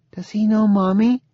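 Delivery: put-on high-pitched small child's voice; little girl. A narrator's reading. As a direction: ask like a curious little girl. Zoe, small child, asks father.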